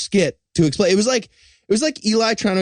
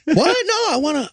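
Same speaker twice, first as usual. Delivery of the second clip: mock surprise; 9 semitones higher than usual. King feigning innocence.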